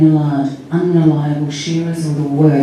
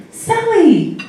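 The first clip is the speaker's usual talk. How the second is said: put-on high-pitched excited little-kid squeal of recognition; excited child; 13 semitones higher than usual. A narrator's reading. Little Chrissy recognising Sally on the phone, excited. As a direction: pipe up high, thrilled like a small child. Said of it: "Chrissy sounded excited".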